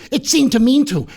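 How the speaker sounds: high-pitched, put-upon protest; mock protest. Clown defends his beloved gun to genius, mock protest. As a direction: voice jumps up high in objection.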